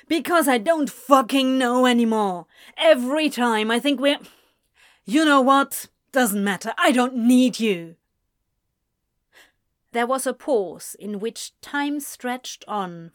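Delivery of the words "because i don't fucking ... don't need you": loud furious rant; angry yell. Acted. Anthony yelling at Ezra, loud and furious. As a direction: let it all out in anger.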